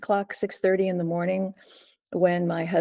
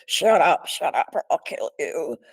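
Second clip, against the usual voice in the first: loud snapped shut up, then rougher voice; imitated barked threat; 4 dB louder than usual. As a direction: bark the order at her, menacing. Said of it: "And it was really like".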